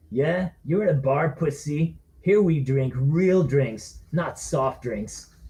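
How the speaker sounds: slow, drawn-out taunt; sneering drunk. Acted. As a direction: drawl out the insult. Bully taunts slowly.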